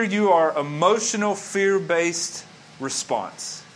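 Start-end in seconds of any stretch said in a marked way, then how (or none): none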